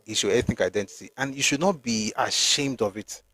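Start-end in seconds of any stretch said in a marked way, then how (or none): none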